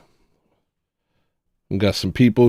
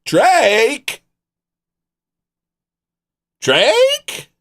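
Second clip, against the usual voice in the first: much higher, rising call. Real, not acted. Calling out to the silent chat, much higher. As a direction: call the name up high like you're searching.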